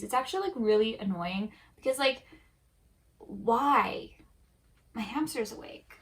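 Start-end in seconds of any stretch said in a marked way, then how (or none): none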